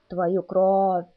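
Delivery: low, eerie vampire howl. Acted. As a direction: howl it like a hungry monster. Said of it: "Внезапно жутко взвыл первый голос". (жуткий вой вампира)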